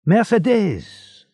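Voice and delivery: drawn-out joyful call, high and loud; slow, high, ringing call of her name; happy shout. A narrator's reading. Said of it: "a voice shouted happily".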